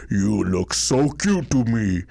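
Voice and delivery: slow, heavy giantess voice; a lightly voiced big woman. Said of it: "she has giant voice".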